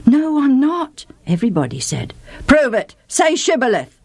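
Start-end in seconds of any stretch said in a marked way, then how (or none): 0.1-1.1 higher, hasty denial; nervous denial. Acted. Fugitives answer the guard. 2.5-4.0 stern guard. Acted. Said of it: "said the guard".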